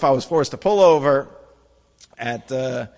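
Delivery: normal talking voice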